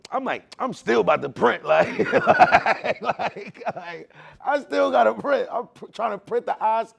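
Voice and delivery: loud, high, laughing through the words; laughing while telling. Real, not acted. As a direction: crack up at yourself mid-story. Laughing at his own stubbornness.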